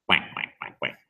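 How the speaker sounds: low, soft duck quacks; duck quacking. Acted. Boy quacking with ducks.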